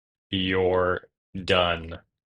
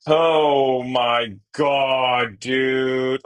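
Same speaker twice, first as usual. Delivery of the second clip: loud, high, drawn-out disbelief; real shock at the opponent's card; 5 semitones higher, 5 dB louder, much slower than usual. Real, not acted. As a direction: stretch it out in shock.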